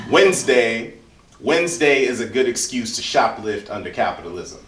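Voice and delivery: measured, rhythmic poetry performance with pointed pauses; spoken word poem. Acted.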